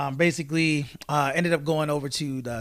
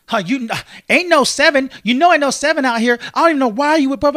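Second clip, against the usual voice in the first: much higher and louder, imitating an angry rant; going off on me; 10 semitones higher, 10 dB louder than usual. Imitating the McDonald's worker going off on him, loud and high. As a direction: mimic her yelling at him, outraged. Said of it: "she's going off on me".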